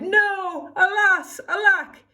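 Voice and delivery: high, loud hammed-up lament; crying out. The prince crying out as Cindy runs, high and loud. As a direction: wail it like a stage hero. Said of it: "The prince cried".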